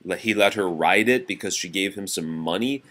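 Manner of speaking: plain reading voice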